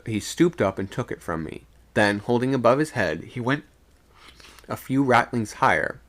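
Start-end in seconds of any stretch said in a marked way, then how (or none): none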